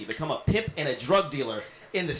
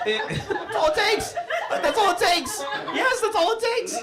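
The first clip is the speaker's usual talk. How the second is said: high-pitched, hammed-up cry of delight; excited squeal; 3 dB louder than usual. Comic playing a man won over by a gift card, hammed-up squeal. Acting out sudden arousal after gift.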